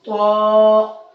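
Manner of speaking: طا sung out heavy and loud; thick taa. Shown as a demonstration. Teacher chanting a heavy طا for the class. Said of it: "تھوڑا سا موٹا کر کے پڑھیں گے".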